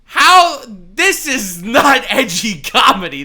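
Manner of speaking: loud, high, slow disbelief; incredulous outburst. Real, not acted. Streamer protests the edgy comedy excuse. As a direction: demand an answer.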